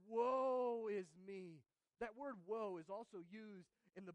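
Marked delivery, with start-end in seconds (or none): 0.1-1.6 slow, loud, drawn-out woe; anguished cry. Acted. Preacher voices Isaiah crying out before God, slow loud anguish. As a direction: wail it slowly from the chest. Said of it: "He says".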